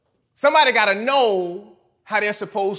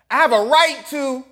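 whiny selfish voice; 8 semitones higher, 5 dB louder than usual. Pretend. Selfish desires voiced aloud.

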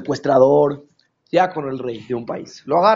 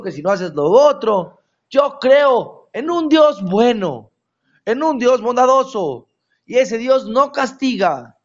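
higher, louder, slow indignant complaint; indignant skeptic; 7 semitones higher, 4 dB louder than usual. Retold or imitated. Skeptic protesting to the rabbi, higher and indignant. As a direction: protest that God is only good.

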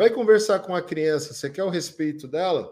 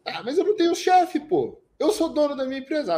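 high, protesting objection; mock an audience member's excuse; pitched-up mock protest from the crowd; objecting listener; 9 semitones higher than usual. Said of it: "audience member objects".

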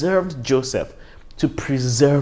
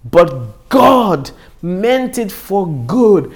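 raised, louder, slowed reading of the verse; preaching at full force; 6 semitones higher, 6 dB louder, slower than usual. Real, not acted. Preacher proclaiming the key verse to the congregation, raised and forceful. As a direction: proclaim it like the climax of the sermon.

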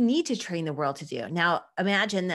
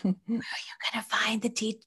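soft unvoiced questioning whisper; whispered inner voice; 3 semitones higher, 4 dB quieter than usual. Inner doubt whispering about finding people, hushed. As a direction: hush it like a nagging thought. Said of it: "turn these voices down to the whispering".

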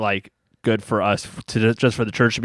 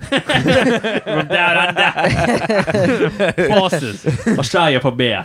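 put-on playful Australian accent through laughter; 6 semitones higher, much slower than usual. Joking to Australian listeners, mock Australian accent. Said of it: "My Australian's not so, not so shabby".

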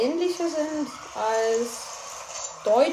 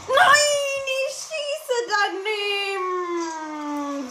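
mock wail; 6 semitones higher, much slower than usual. Misses shot, mock wail.

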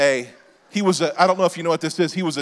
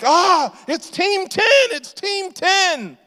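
put-on excited kid; 14 semitones higher, much slower than usual. Son spotting the influencer crew on the pier, high excited squeal. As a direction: squeal like a child spotting idols. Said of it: "my kid's like".

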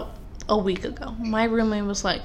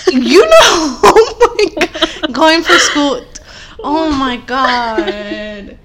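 laughing at herself; 5 semitones higher, 9 dB louder, much slower than usual. Real, not acted. Laughing at own slip.

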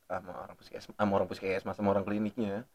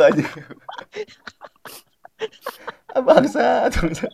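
laughing; 7 semitones higher, 9 dB louder than usual. Real, not acted. Cringing at circumcision joke, laughing.

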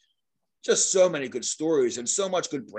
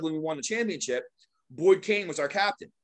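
ordinary talk

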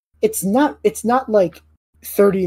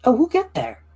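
reassuring NPC; 10 semitones higher than usual. Acted.

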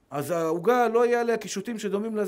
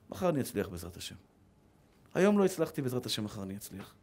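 quiet; 13 semitones lower, 9 dB quieter than usual. Acted. Quiet self-comfort after a bad lesson.

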